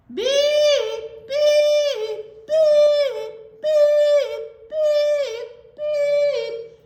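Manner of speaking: long, loud, high monotone beeps; robot beeping. Pretend. Storyteller being the last noisy robot, long beeps. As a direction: blare each beep separately like an alarm.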